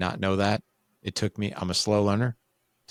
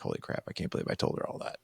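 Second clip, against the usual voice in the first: much softer, voiceless mutter to yourself; retold stunned aside; 9 dB quieter, faster than usual. Man to himself after telling stepmom everything, stunned undertone. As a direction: say it under your breath in disbelief.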